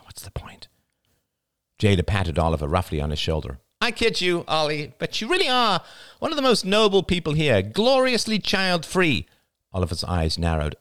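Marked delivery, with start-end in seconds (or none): 0.0-0.7 very soft, voiceless sigh of words; resigned murmur. A narrator's reading. Oliver thinking it without answering, resigned whisper. 3.8-9.3 high nasal gushing, slowing at the end; Jada, mock praise. A narrator's reading. Jada teasing Oliver after a rough pat, high mock admiration.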